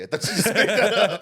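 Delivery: loud, high laughing "made that up"; laughing disbelief. Real, not acted. Guest laughing at the made-up sounding slang. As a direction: laugh it out, half accusing.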